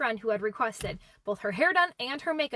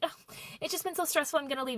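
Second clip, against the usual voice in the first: high, softer mock whine; put-on feeble, higher-pitched complaint; self-pitying excuse; 6 semitones higher, 3 dB quieter than usual. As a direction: imitate a friend pleading stress to get away. Friend whines about stress, acted.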